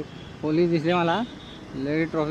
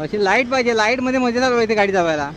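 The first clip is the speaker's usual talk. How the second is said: excited; 5 semitones higher, 6 dB louder than usual. Real, not acted. Delighted by bright road lights.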